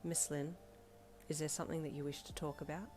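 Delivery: soft, gentle concern. Acted. Teacher gently asking the upset girl to talk, softly. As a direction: ease off and ask kindly. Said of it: "he asked in a more gentle voice".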